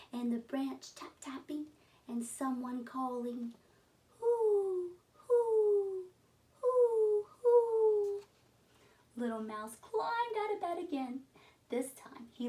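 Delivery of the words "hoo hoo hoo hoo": high, loud hooting owl call. Acted. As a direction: hoot like an owl in the dark. Owl calling in the night.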